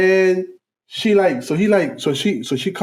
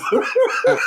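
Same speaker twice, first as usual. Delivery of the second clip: high laugh. Real, not acted.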